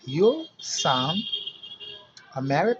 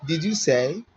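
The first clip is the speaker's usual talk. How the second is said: usual speaking voice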